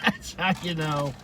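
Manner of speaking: slow chuckling repeat; laughing. Shown as a demonstration. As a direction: say it again through a laugh.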